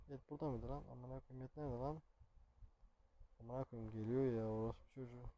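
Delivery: very quiet, nervous cursing; tense hushed muttering. Real, not acted. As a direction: keep it down as danger nears. Searching as Granny approaches. (gergin, kısık sesle söylenme)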